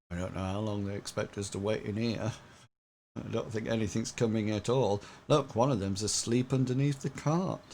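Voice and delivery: low, soft, fast grumble; grumbling mercenaries. Acted. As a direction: mutter it quickly as a bored guard. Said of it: "sort of like saying".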